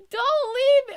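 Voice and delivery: high, loud mock wail; hammed-up plea. Player begging Neil not to leave, hammed-up wail. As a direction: cry it out in mock desperation.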